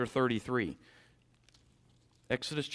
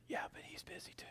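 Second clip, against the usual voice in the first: put-on child's whisper; 18 dB quieter than usual.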